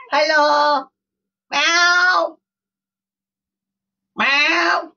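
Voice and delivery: high sing-song pet voice; high cooing voice for a pet. Real, not acted. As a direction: coo up high at the cat. Greeting cat with meows.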